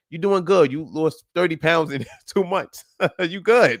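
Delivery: chuckling through the good news; amused, proud encouragement; laughing praise. Real, not acted. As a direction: laugh as you cheer her on. Coach praising client, laughing.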